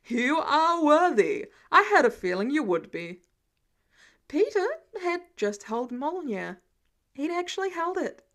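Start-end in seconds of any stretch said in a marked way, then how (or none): 0.1-3.2 Thor, loud and pleased; booming Thor. A narrator's reading. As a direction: boom it out like a jovial thunder god. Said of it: "Thor laughed, clapping him on the back".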